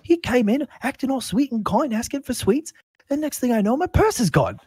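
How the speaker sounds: put-on high, loud old man's complaint; aggrieved old shopkeeper. Shopkeeper reporting his stolen purse, high and aggrieved. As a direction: pour out the grievance in a high voice. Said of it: "shopkeeper's like".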